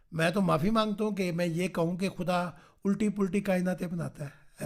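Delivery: ordinary delivery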